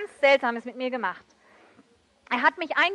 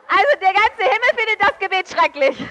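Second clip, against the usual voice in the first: laughing; 6 semitones higher, 4 dB louder than usual. Real, not acted.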